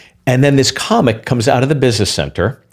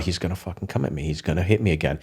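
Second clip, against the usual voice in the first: hushed inner thought; 5 semitones lower, 12 dB quieter, faster than usual. Retold or imitated. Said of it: "I'm going".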